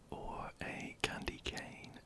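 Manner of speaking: ordinary delivery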